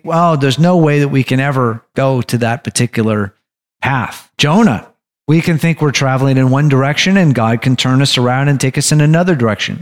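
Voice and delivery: ordinary delivery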